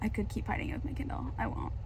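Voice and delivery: fast hushed whisper, barely voiced. Real, not acted. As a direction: whisper it so no one nearby hears. Hiding reading from others.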